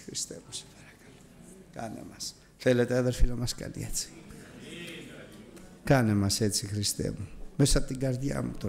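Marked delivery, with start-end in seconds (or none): 0.0-2.3 breathy whispered plea, barely audible; whispered prayer. Real, not acted. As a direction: pray it under your breath, softly.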